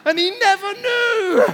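very high, loud, slow sing-song reveal; triumphant punchline. Real, not acted. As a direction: crow the secret out comically. Comic reveal of cake secret.

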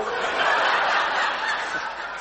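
loud laughter; real loud laugh. Real, not acted. Laugh at Satan's jab.

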